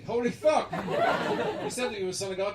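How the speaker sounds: high, loud disbelief; amazed apostles. Retold or imitated. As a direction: shout it in wonder.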